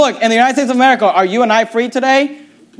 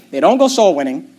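normal talking voice

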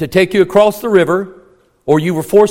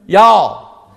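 Southern accent; 3 dB louder than usual. Shown as a demonstration. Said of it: "Did I say that right?".

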